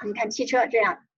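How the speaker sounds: ordinary talk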